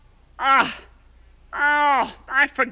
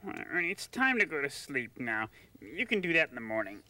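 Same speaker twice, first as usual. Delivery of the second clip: hushed bedtime voice; 7 semitones lower, 13 dB quieter than usual. Acted. Whispering in bed at night.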